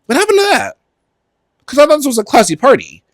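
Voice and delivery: high-pitched campy scolding; hammed-up, high indignant complaint; mock outrage. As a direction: scold the crowd in a high, put-on voice.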